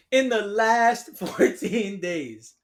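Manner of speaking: slow repeat of "In the last fourteen days", laughing through "last"; laughing in disbelief. Real, not acted.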